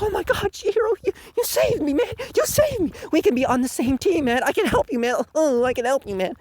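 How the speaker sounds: high-pitched fawning impression; mock grateful Hakuri. Rescued loser begging to join Chihiro, mock-gushing. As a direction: gush eagerly at a rescuer.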